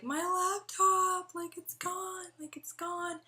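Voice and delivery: very high, drawn-out mock wail; retold wail. Distraught student to teacher about stolen laptop, wailing. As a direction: wail it in a high voice, stretching the first words.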